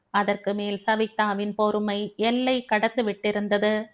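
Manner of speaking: ordinary delivery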